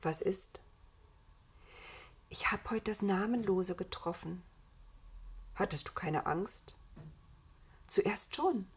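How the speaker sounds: hushed night-time whispering; whispered exchange. A narrator's reading. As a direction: keep it very soft, as if lying close before sleep. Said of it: "flüsterte Adam zurück". (leises Flüstern vor dem Einschlafen)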